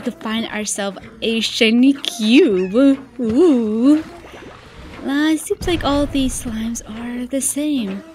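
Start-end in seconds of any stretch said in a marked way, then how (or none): none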